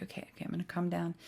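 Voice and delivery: quick, soft self-talk; self-calming. Retold or imitated. Retelling calming herself after the painful bump, hushed and quick. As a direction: talk yourself down quickly and quietly. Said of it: "I'm just calming down".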